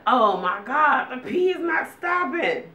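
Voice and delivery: high, slow panicked cry about the pee; alarmed and squealing up high; panicked exclaim. Retold or imitated. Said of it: "And I'm like".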